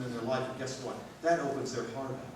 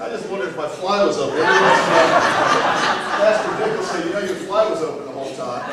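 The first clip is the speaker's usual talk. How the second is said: chuckling through the words to the giggling crowd; laughing along; 3 semitones higher, 13 dB louder than usual. Real, not acted. Pastor laughing along with his giggling congregation, loud and bubbling. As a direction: let the laughter spill through every line.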